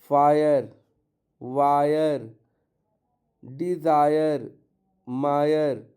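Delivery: "फायर" "वायर" said with a full aiyer glide; aiyer demo. Shown as a demonstration. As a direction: glide through the aiyer sound. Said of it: "आई का उच्चारण आए". ("आयर" ध्वनि पूरी खींचकर बोलना)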